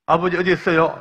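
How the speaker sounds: loud search call, stress on 어디; calling out again. Retold or imitated. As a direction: call out blindly, hitting 어디. Hooded son calling again. (애타게 다시 부르는 외침)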